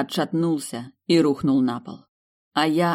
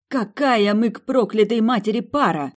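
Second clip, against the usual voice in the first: high-pitched, indignant outburst; seething; 8 semitones higher, 4 dB louder than usual. A narrator's reading. Heroine to the demon who called them a couple, seething. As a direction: throw it out hot with outrage. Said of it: "Я кипела".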